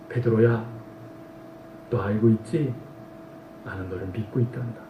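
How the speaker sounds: almost under the breath, comforting; Jesus quietly reassuring Peter, tender and soft; tender reassurance. Acted.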